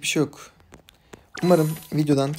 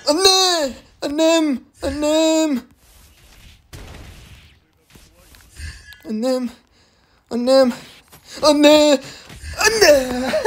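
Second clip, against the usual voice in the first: high loud wails of Anne; repeated high shouts of Annem; screaming for mom; 10 semitones higher, 4 dB louder, much slower than usual. Real, not acted. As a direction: yell for your mother in comic despair. Calling mom in game panic.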